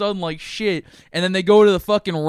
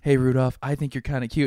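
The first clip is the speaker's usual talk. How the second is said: a lower, flirty grown-woman voice; forty-year-old woman voice; 6 semitones lower, 3 dB quieter than usual.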